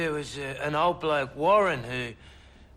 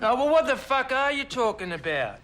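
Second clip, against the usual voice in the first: higher, louder fed-up yell; raised, louder swearing at the lawyer; exasperated outburst; 7 semitones higher, 4 dB louder than usual. Acted. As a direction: blow up in frustration. Witness loses patience.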